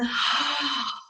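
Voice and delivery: long audible sighing breath out; audible exhalation. Shown as a demonstration. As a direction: let the breath go in a demonstrative sigh. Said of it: "Audible exhalation".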